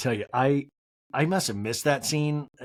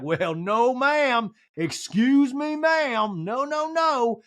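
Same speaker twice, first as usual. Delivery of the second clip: mock Sutton; 9 semitones higher, 3 dB louder, much slower than usual. Sutton's heated retort, voiced.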